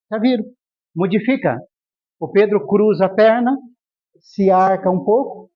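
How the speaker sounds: usual speaking voice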